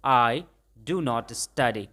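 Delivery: very slow, word by word; slow model sentence. Shown as a demonstration. Teacher models the negative for students, very slowly. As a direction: dictate it slowly. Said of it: "अगर ऐसा बोलेंगे तो कैसे बोलेंगे".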